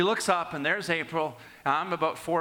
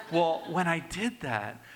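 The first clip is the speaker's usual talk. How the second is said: normal talking voice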